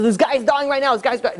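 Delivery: high, urgent mock panic; acted urgent alarm. Robber rushing the rabbi into hospital, acted panic.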